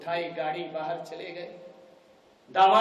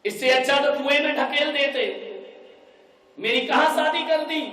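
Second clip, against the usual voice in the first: angry complaint; 7 semitones higher, 7 dB louder than usual. Acted. Bride wails on the phone.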